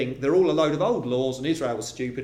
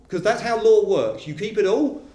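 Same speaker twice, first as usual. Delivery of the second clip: higher, louder and pressing; insistent preaching; 7 semitones higher, 5 dB louder than usual. Acted. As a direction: drive the point home to the listener. Preacher voicing Paul, insistent.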